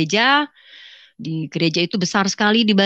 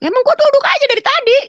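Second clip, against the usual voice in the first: sudden high, loud outburst; irritated shout; 8 dB louder, faster than usual. Retold or imitated. Wife snaps back at husband who says sit down, yelling. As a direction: explode in a much higher, louder voice.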